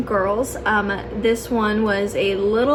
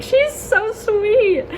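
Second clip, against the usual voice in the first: slow high gushing; 11 semitones higher, 4 dB louder, much slower than usual. Retold or imitated. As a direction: swoon over her. Gushing about potential big.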